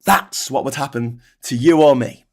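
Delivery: usual speaking voice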